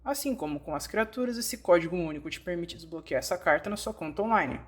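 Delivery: ordinary talk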